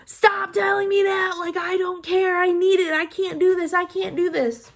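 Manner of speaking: high, frantic mock scream; screaming and crying. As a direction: act out a hysterical protest in labor. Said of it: "I'm screaming, crying".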